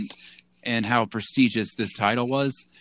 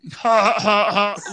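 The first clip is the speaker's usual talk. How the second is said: big helpless laugh, high and loud; loud burst of laughter; 8 semitones higher, 6 dB louder than usual. Real, not acted. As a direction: crack up hard at the joke. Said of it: "Leave out with them jokes".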